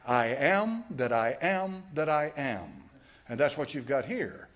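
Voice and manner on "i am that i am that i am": slow, insistent "I am that I am that I am."; emphatic I am. Shown as a demonstration. Preacher expounds God's name to the congregation, slow and emphatic. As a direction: lean on each "am" as a sweeping claim. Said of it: "ego eimi is an emphatic".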